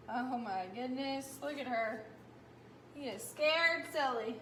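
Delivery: ordinary delivery, nothing marked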